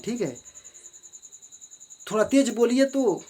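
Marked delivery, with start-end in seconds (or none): 2.1-3.2 higher and louder urging; emphatic command. Shown as a demonstration. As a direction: insist they speak up. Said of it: "ये क्या है, emphatic है".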